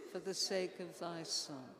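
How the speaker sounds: ordinary delivery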